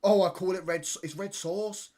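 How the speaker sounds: mock Birmingham accent. Mocking Birmingham people who say red sauce, loud put-on Birmingham accent. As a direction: mimic Birmingham people, exaggerated and high. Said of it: "these people from Birmingham were like".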